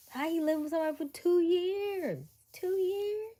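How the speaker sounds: high-pitched outraged disbelief; incredulous. Real, not acted. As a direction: squeak out your disbelief. Outraged reaction to the story.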